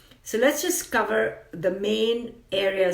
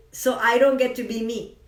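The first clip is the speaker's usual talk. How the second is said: ordinary talk, nothing marked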